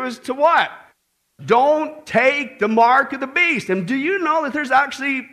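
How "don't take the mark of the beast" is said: slow, loud and weighty; preacher driving it home to the congregation, slow and loud; slow emphatic warning. Acted. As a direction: hammer each word of the warning home.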